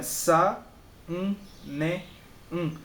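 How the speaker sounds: "sa, um, ne, um" in four separate beats; N sounded alone. Shown as a demonstration. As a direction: isolate each "um" in "sa, um, ne, um". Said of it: "Você tem que pronunciar ela sozinha".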